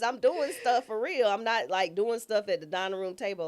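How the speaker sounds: mock-proud excitement; excited. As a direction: pump it up like a proud go-getter. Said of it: "I'm all excited".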